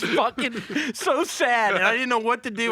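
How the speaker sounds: laughing. Real, not acted. Recalling bombing in silence.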